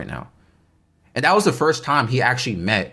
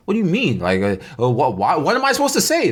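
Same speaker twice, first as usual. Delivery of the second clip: retold bewilderment; 7 semitones higher than usual. His thought when put on spot.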